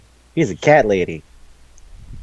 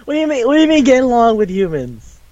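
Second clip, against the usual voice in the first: fast, much higher, panicky; freaked-out disbelief; 11 semitones higher, faster than usual. Retold or imitated. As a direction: spill out the question in alarm. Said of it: "Sukune just freaks the fuck out".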